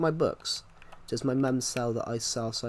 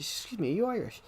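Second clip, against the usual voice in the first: put-on higher-pitched Irish accent; imitated Irish girl, pitched up; 8 semitones higher, 3 dB quieter than usual. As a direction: mimic an Irish girl asking. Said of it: "she was actually Irish herself".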